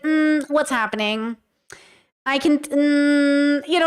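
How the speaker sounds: a slow, drawn-out sassy "Mm, what's happening?". Shown as a demonstration. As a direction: tease your partner with slow sass.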